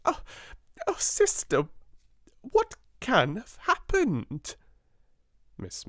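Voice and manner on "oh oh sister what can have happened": high, slow, tearful wail; crying. Acted. Amelia begs her angry sister, crying. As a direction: wail it out, near tears. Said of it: "wring her fat hands and cry".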